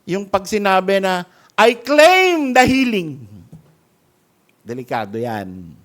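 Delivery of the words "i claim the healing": slow, loud, mock confident claim; mock false claim. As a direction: parody a presumptuous prayer. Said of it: "mga false".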